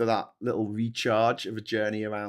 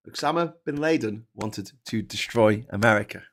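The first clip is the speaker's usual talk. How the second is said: usual speaking voice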